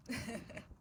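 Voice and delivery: brief self-amused chuckle; short laugh. Real, not acted. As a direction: laugh softly at yourself. Laughs at herself mid-story.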